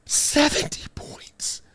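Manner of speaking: whispered in disbelief; incredulous whisper. Real, not acted. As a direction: breathe "Seventy points?" in awe. Disbelief at seventy points.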